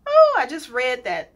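ordinary talk, nothing marked

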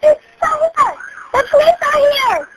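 high, urgent panicked voice; frantic. Real, not acted. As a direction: blurt it out in panic. Frantic near the fire.

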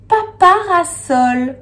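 papa high and loud in papa parasol; papa parasol called out high, stressing papa; papa picked out. Shown as a demonstration. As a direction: punch papa, then draw out parasol. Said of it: "On entend encore une fois papa".

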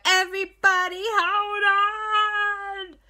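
loud, high, drawn-out call; shout. Acted. Auntie Lisa warns everyone in the canoe, shouting slowly. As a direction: yell over the rushing water.

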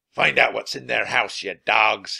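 loud pirate order; commanding villain voice; gruff pirate captain. A narrator's reading. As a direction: bark orders at your crew like a pirate boss. Pirate captain orders his crew.

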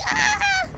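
high, loud mock shriek; piercing put-on yell; imitated crazed scream. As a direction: screech like a madman charging. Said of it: "He's like".

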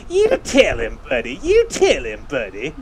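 put-on voice of a backing crony; lightly mimicked yard-side supporter; imitating brother's friend. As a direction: egg him on like a sidekick. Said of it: "Buddy's business friend in the yard".

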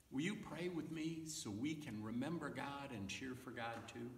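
normal talking voice

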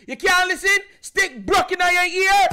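son's mom scolds him for not listening, loud Jamaican yell; mad Jamaican mom. Retold or imitated. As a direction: yell it like an angry Jamaican mother. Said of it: "That's when you know my mom get mad".

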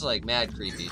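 ordinary talk

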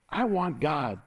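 ordinary talk

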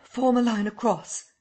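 high, loud command; crying out. Acted. Kemp commands the crowd, high and loud. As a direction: order the crowd into line. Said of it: "he cried".

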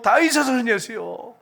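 high, drawn-out plea to Jesus; reenacted loud cry for mercy; crying out loudly. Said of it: "큰 소리로".

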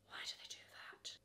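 fast whispered aside; quick whispered question. Real, not acted. Whispered aside about weird sneakers.

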